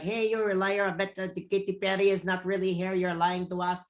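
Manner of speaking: high, clumsy Filipino accent impression. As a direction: imitate a Filipino friend with a terrible accent. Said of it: "That's my Filipino accent. It's terrible".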